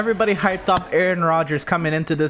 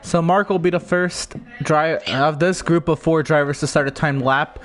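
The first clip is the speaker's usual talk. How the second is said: race commentary. Acted.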